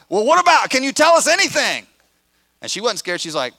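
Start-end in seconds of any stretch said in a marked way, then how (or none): 0.1-1.9 high, loud call after someone. Retold or imitated. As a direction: shout a question after a departing worker. Stranger calling out to employee.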